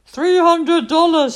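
name the price grandly; sly seller. Acted. Julie tells the neighbourhood girls her price, high and slow. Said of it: "said Julie".

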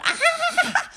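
high yelp with a laugh; mock-horrified yelp; shocked shriek. Father sees Julie's makeup and yelps high. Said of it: "her father looked up and yelled".

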